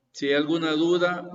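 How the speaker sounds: ordinary delivery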